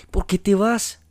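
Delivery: high, loud demand; desperate shout. A narrator's reading. Juan Pablo gripping María, shouting. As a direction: shake the answer out of her.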